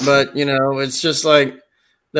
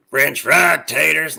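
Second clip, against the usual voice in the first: Sling Blade voice; slower than usual. Pretend. Doing Sling Blade at the drive-thru, slow drawl. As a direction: drawl it out like Karl. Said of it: "Sling Blade".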